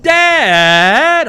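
"Dad" stretched high and loud, its vowel bending; whiny kid's Dad. Retold or imitated. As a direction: complain "Dad" like a child who thinks it's impossible. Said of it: "changing vowel in there".

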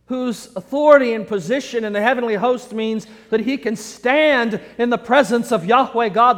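ordinary talk

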